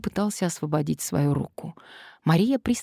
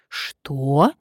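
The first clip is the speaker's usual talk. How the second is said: incredulous; 4 dB louder than usual. Acted. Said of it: "переспросила Надежда недоверчиво".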